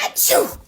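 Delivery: high, breathy explosive achoo; big sneeze. Acted. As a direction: blast out a huge sneeze. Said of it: "I sneezed a great sneeze".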